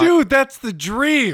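high, loud, drawn-out delight; excited outburst. Real, not acted. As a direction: exclaim it slowly like a dream come true. Thrilled reaction to sniper spot story.

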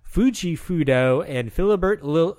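ordinary talk

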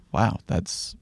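slow, hushed, gentle sympathy; calm soft voice. Shown as a demonstration. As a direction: breathe it out softly and slowly, like comforting a friend. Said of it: "I recommend talking in a calm voice".

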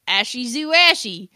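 "Ashy Zoo Ashy" with English flat a and ee endings; "Ashy Zoo Ashy" as English words; mangled German. Retold or imitated. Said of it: "made it German".

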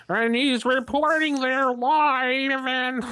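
high, slow hammed-up newscaster; live reporter voice. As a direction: stretch it out like a live announcer. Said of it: "You have to do the whole".

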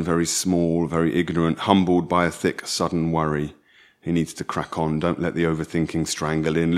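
low, even, brooding reading; story narration. A narrator's reading. Narrating Shai alone with his night terrors, low literary reading. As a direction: narrate a lonely worry in a low voice.

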